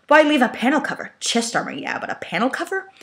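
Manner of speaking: high, incredulous questioning; puzzled question. Acted. Thrust baffled at the odd clue. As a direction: ask it like it makes no sense.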